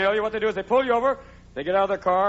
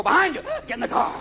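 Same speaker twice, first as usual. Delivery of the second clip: much higher, rushed cop voice; high wired cop; 10 semitones higher than usual. Retold or imitated. Cokehead cop yelling the driver back into the car, high and tense. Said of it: "the police just go".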